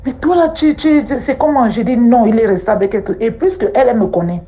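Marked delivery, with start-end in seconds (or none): 0.1-1.7 amie inquiète. Retold or imitated. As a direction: question inquiète, nettement plus aiguë. Friend alarmed by her cries. (l'amie demande ce qui se passe, ton alarmé et aigu)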